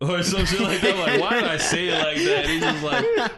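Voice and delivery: words breaking up with laughter, pitched high; laughing. Real, not acted. Speaker embarrassed by own Naruto accent, laughing incredulously. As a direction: crack up at yourself while talking.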